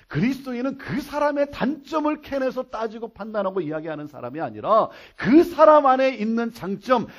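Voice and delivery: loud, high, driving sermon delivery; rousing pulpit crescendo; impassioned preaching. Real, not acted. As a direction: proclaim it to the congregation at full force. Preaches at full force.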